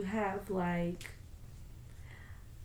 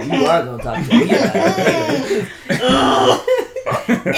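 laughing; 7 semitones higher, 12 dB louder than usual. Real, not acted. Teasing through laughter.

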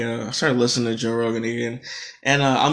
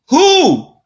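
much higher, louder exasperated "Who?"; incredulous outburst; 14 semitones higher, 8 dB louder than usual. Real, not acted. Ranting about the unnamed person, loud incredulous yelp. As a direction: yelp it in disbelief.

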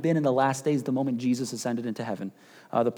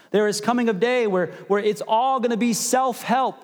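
high, louder southern preacher voice; southern accent; 7 semitones higher, 4 dB louder than usual. Acted. Mocking a self-help pastor, in a southern accent. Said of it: "that was my southern accent".

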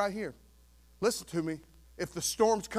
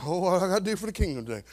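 mock fretting; 3 semitones higher than usual. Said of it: "I'm not working up every Monday going".

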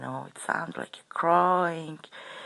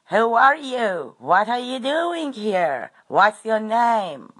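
high, loud, drawn-out Aussie questioning; attempted Aussie accent; 8 semitones higher, 6 dB louder, slower than usual. Old Australian bloke questioning a stranger at the fire, attempted Aussie accent. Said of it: "trying to talk with Aussie accents".

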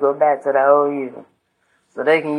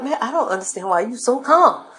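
higher-pitched imitation of a baffled friend; baffled homegirl; 8 semitones higher than usual. Homegirl questioning the calm speaker, baffled. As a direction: act out her exasperated disbelief.

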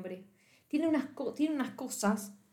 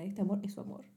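ordinary talk

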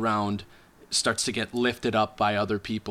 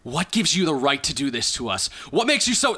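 climbing high, louder outburst; indignant challenge; 4 semitones higher, 4 dB louder than usual. Acted. Man grabbing the halfling, indignant challenge. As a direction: confront them, pitch rising as it builds. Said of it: "grabs the halfling and says".

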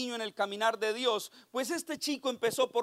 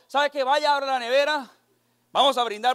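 voicing the mother; 3 semitones higher, 6 dB louder than usual. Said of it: "y me dijo".

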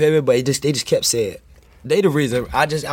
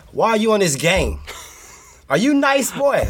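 laughing, much higher pitched disbelief; teasing his friend with a laughing, high-pitched voice; incredulous; 8 semitones higher than usual. Retold or imitated.

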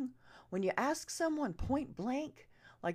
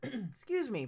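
ordinary delivery

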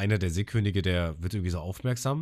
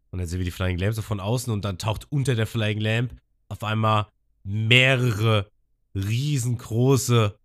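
ordinary delivery

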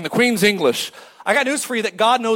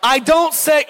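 usual speaking voice

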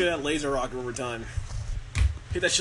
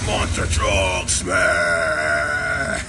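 much lower, very slow shout; deep monster truck voice; 12 semitones lower, much slower than usual. Pretend. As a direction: drag it out deep and booming. Ad parody finale.

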